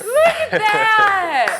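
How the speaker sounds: excited laughing shout. Real, not acted. Mask reveal, laughing shout.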